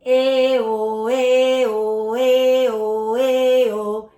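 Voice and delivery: loud "Eh, oh" switching bright to round; vocal warm-up vowel drill, loud; alternating eh and oh. Shown as a demonstration. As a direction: swing the palate up and down on each vowel. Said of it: "do it with me".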